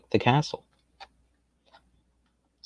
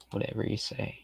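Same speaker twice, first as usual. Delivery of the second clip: whispered aside; 13 dB quieter than usual. Real, not acted. Muttering back at chat.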